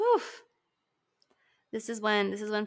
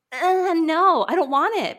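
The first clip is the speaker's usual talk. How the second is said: rejecting it; 7 semitones higher, 8 dB louder than usual. Retold or imitated. Refusing gentle parenting.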